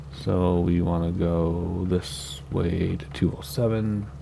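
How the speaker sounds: usual speaking voice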